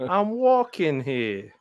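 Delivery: slow mock New York shout; hammed-up New York cabbie; New York accent. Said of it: "the accent really shines through".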